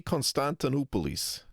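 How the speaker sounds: Constantinopolis said "kon-stan-tin-OO-po-lis"; full Greek ending on Constantinopolis; Greek pronunciation. Shown as a demonstration. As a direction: stress Constantinopolis before the "-polis" ending. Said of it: "Those are the Greek pronunciations".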